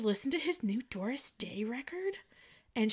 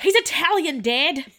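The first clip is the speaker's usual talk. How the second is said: put-on higher, louder exasperated teen; exasperated correction; 8 semitones higher, 11 dB louder than usual. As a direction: snap back at dad, fed up. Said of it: "She's like".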